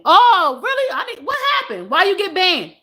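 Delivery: high-pitched, startled questioning; surprised and curious. Real, not acted. Host hears viewer got banned, surprised. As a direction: jump up in pitch, demand the story.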